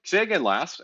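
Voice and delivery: clipped radio call; mock radio operator asking for a repeat; crisp radio-speak request. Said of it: "they'll be like".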